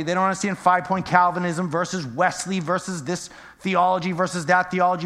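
normal talking voice

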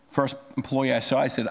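ordinary talk, nothing marked

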